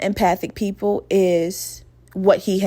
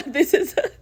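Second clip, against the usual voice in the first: higher words broken by a laugh; 9 semitones higher than usual. Real, not acted. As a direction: crack up at your own foolishness. Laughs at her own dumb reply.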